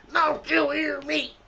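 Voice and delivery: outraged supervisor yelling across the break room; berating shout. Retold or imitated. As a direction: bark it down at him like an angry boss. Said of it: "berate me in front of".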